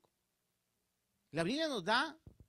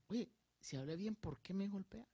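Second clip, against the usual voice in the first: softer, gentle asking; calm inquiring tone; calm question; 8 dB quieter than usual. Shown as a demonstration. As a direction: ask it quietly, without reproach. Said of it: "el Señor con la característica que tenía, dice".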